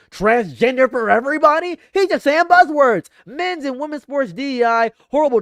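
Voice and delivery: a sudden loud, high burst of outrage; loud mock outburst. Mocking Trump speech, loud outburst.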